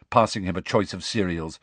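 ordinary delivery

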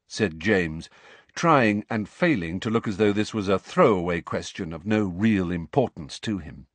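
ordinary talk, nothing marked

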